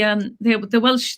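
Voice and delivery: ordinary delivery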